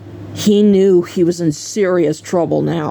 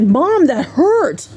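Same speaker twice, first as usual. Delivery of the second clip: higher, drawn-out complaint; mock hurt whine; 7 semitones higher, much slower than usual. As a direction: imitate a smarting son protesting. Spanked son complains, retold.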